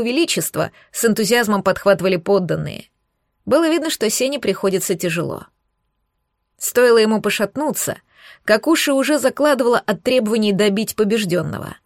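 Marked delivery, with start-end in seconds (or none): none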